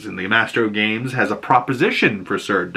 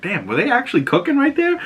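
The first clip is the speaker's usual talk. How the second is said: much higher, amazed question; surprised; 12 semitones higher than usual. Retold or imitated. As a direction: gasp of disbelief at the screen. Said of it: "I was like".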